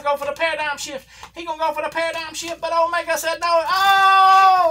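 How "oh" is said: loud, high wincing cry; pained shout. Real, not acted. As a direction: cry out as if it hurt you. Wincing at a groin spot.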